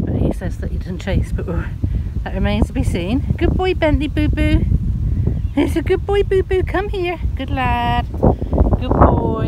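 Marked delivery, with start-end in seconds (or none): 3.4-9.5 much higher, louder sing-song praise; high loud pet voice. Real, not acted. Cooing at the dog.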